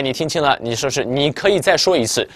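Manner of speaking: ordinary talk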